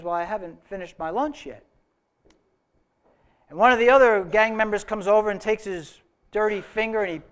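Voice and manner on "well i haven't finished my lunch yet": calm and softer; calmly. Retold or imitated. Trucker answering the bikers, calm and softer. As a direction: answer them unbothered, softly. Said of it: "very calmly says".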